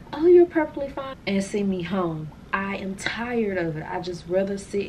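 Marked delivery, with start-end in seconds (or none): 0.1-1.1 high, loud mocking doctor's brush-off. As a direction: sarcastically imitate the doctor. Mocking doctors' dismissive verdict.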